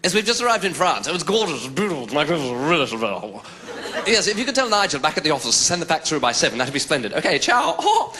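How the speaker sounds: lightly voiced posh businessman on the phone; breezy, pleased with himself; posh phone caller. Businessman phoning the office from the train, breezy and posh. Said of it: "He goes".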